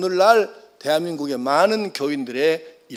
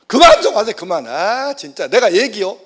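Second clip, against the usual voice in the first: high, loud snap that slows at the end; fed-up son; 3 semitones higher, 6 dB louder than usual. Retold or imitated. Second son snapping at father to stop lecturing, loud and high. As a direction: cut off the nagging father in irritation. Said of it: "이러는 거예요".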